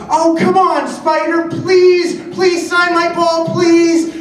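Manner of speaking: put-on loud, high-pitched kid begging; Zach. Little kid begging reluctant player to sign, high loud pleading. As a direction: plead insistently as an imitated small boy. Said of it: "And he's like".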